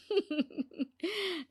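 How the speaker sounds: a short, soft, smug chuckle to herself; smug laugh. Acted. Mother-in-law gloating over her secret power, smug chuckle. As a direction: laugh like a sly schemer.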